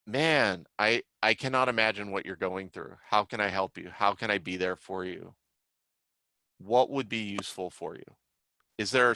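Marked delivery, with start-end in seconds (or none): none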